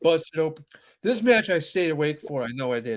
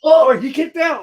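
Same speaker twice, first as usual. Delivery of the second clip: excited exclamation; 8 semitones higher, 9 dB louder than usual. Real, not acted. Wrestling dog, excited.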